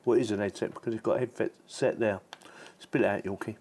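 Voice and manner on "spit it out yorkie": normal talking voice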